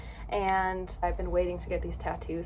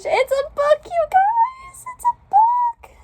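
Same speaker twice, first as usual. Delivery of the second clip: much higher and much louder, squealing; ecstatic squeal; 10 dB louder, slower than usual. Real, not acted. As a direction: shriek it to the viewers in delight. Discovers book tattoo detail.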